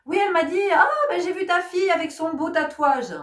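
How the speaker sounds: imite la mère, aigu et appuyé; intonation de la mère. Retold or imitated. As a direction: reproche sous-entendu de maman, plus haut et plus fort. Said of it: "j'adore son intonation quand elle me le rapporte". (voix de la mère qui sous-entend un reproche)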